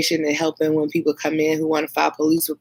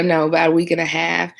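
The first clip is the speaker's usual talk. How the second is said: ordinary talk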